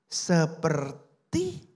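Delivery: very slow, stalled word that cannot go on; faltering, stuck. A narrator's reading. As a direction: choke on the word, unable to continue. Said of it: "dia nggak bisa nyambung".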